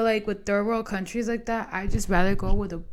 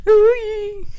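much higher and louder startled laugh into an ooh; shocked laugh; 14 semitones higher, 9 dB louder than usual. Retold or imitated. Retelling her reaction to her dad's warning, a high loud mock squeal. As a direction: squeal it like the news just hit. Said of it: "And I was like".

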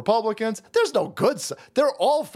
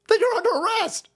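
much higher-pitched exclamation; escalating mock outrage. Judge ordering county officials arrested, high-pitched mock outrage.

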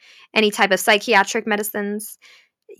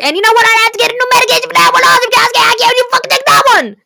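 very high, loud, rushed outburst; shrieked sarcastic fed-up rant; exasperated screaming rant; 13 semitones higher, 7 dB louder, much faster than usual. Real, not acted. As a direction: build into a frustrated high-pitched yell. Rant peaks over losing medication.

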